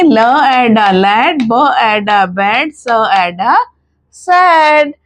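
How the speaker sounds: slow and loud: ल एड लैड, ब एड बैड, सैड; loud blending drill. Shown as a demonstration. Teacher loudly drilling mothers on blends. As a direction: call out the blends loudly and slowly. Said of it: "मैं इसको पढ़ती हूँ".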